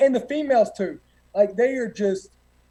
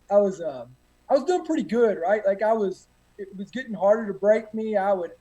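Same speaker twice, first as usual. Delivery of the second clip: ordinary talk, nothing marked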